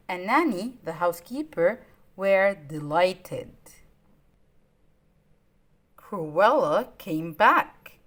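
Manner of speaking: plain reading voice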